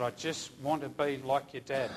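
soft, low and sincere; quiet, heartfelt re-enactment; tender reassurance. Retold or imitated. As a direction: offer it gently, voice dropped low. Tender offer to grieving man.

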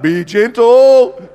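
loud, high, drawn-out mock plea; mock calling out. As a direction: stretch it out in an exaggerated call. Retold plea called to healer.

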